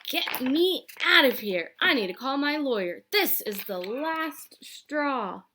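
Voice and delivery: loud, high, slow fury; loud slow last straw; furious demand. A narrator's reading. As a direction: lay down the final word. Injured old lady demanding to leave.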